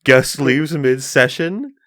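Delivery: slow, loud, high title read; clickbait title. Acted. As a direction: announce it like a shocking headline. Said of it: "That'll be the title".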